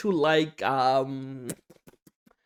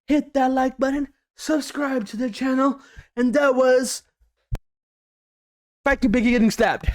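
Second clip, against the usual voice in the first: youtuber outro; 7 semitones higher than usual. Acted. Signing off to viewers, upbeat outro voice.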